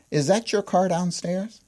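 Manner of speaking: puzzled coworker. Retold or imitated. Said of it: "they had that puzzled look".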